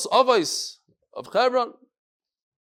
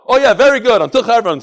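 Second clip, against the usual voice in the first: loud, emphatic acknowledgment; acted enthusiastic agreement, much louder; hearty approval; 4 semitones higher, 11 dB louder than usual. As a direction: shout back your hearty thanks. Acted delighted reply to the roof.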